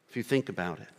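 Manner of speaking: normal talking voice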